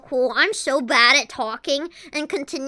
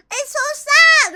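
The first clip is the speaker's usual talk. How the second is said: high, slow, fake-sad whine; mock sad whine; 10 semitones higher, 8 dB louder, slower than usual. Pretending to be shaved, mock sad whine. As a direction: whimper it like a pouting pet. Fake whining about shaving.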